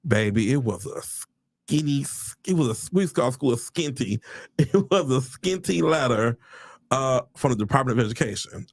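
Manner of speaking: ordinary talk, nothing marked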